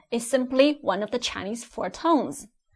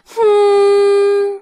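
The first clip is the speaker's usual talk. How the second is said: long, loud, high level hum; high-pitched hum; 8 semitones higher, 9 dB louder than usual. Shown as a demonstration. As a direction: hold one high flat humming note. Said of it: "High pitched".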